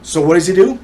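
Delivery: normal talking voice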